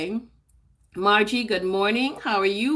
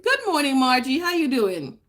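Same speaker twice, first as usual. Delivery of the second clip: usual speaking voice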